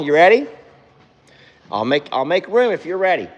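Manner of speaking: high sweet coaxing call; sing-song encouragement to the horse. Real, not acted. Owner coaxing her horse out of the stall, high and sweet.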